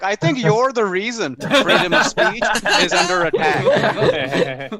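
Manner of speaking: laughing slow retort; pointed comeback. Retold or imitated. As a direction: land the accusation slowly. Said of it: "And I was like".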